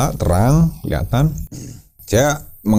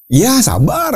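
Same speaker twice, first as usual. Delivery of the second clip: calling back; 13 semitones higher, slower than usual. Retold or imitated. Answering friend's call.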